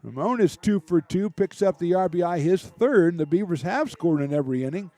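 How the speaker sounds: loud, high baseball call as the run scores; excited play-by-play. Real, not acted. Live call of scoring play.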